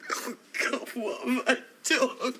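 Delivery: high, breaking, tearful voice; distraught. Acted. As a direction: choke out the words in anguish. Breaks down over her confession.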